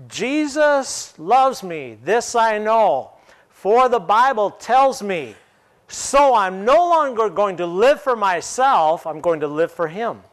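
preacher voicing the believer's vow, fervently; fervent declaration. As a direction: proclaim it with rising fervor. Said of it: "I'm gonna respond".

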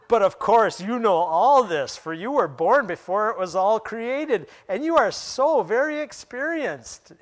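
high, loud, exaggerated mock praise; heavy sarcasm. God answering Job about creation, sarcastic. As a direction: swoop up and lean on it with obvious sarcasm. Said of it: "Hear the sarcasm in God's voice".

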